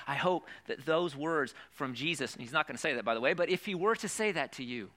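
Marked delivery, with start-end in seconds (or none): none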